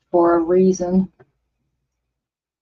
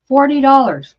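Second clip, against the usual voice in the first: higher, stressed on dollars; incredulous emphasis; 6 semitones higher than usual. Real, not acted. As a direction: repeat it in disbelief.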